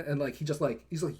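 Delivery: usual speaking voice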